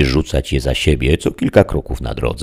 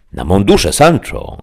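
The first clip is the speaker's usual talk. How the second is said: exclaiming; 4 semitones higher, 3 dB louder than usual. A narrator's reading. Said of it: "zawołał Don Quixote".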